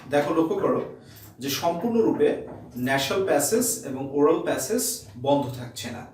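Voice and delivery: ordinary talk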